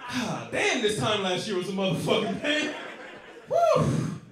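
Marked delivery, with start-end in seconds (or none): 3.5-4.2 high, loud celebratory whoop. Real, not acted. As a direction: let out a big whoop. Punchline whoop.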